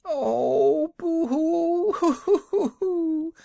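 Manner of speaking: very high, slow wailing sobs; loud sobbing boo-hoo. A narrator's reading. Woman bursts into fresh sobbing before the travellers. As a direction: sob out loud in fresh grief. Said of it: "sobbed the woman".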